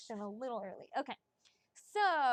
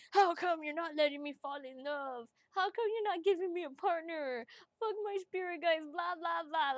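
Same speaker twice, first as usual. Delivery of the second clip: whiny retold rant, a bit loud and raised; mock angry cussing; 5 semitones higher, 4 dB louder than usual. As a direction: imitate yourself griping at your spirit guides. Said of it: "I was so mad. I cussed at my spirit guides".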